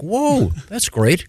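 high, drawn-out wonder; slow, put-on marvelling; mock amazement. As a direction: act awed, slowly and high. Mock reaction to sudden fireworks.

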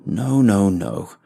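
loud, sharp no no no; angry refusal. Acted. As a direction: cut them off, displeased. Captor rejects the weak laugh.

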